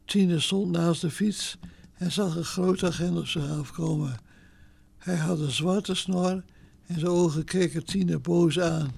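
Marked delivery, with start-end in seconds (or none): none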